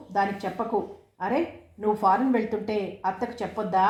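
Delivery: usual speaking voice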